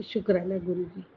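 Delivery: ordinary delivery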